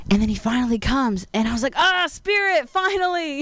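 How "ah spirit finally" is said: relieved greeting. Retold or imitated. Greeting friend, voiced high.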